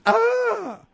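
high, loud mocking shriek; host mimicking the pastor's version. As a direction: shriek like a frightened lady. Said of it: "this is the way it's being received".

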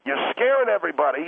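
ordinary talk, nothing marked

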